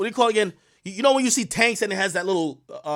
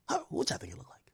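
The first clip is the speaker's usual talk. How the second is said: hushed teasing question; conspiratorial whisper to the audience; 6 semitones higher, 17 dB quieter, faster than usual. Real, not acted. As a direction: lean in and whisper it.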